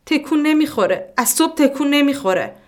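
a frightened mother begging for help; distraught woman. Acted. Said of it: "صدای گریهٔ زن بلند شد". (زنی پریشان و ملتمس با صدای بلند)

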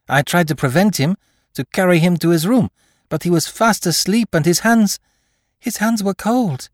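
high and loud, rising alarm; panicked nurse. A narrator's reading. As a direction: blurt it out in fear.

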